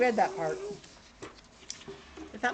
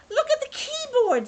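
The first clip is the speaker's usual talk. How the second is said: high, loud squeal of discovery; excited; 13 semitones higher, 5 dB louder than usual. Acted. Kate at the window spotting a keyboard, excited. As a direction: point it out with high delight.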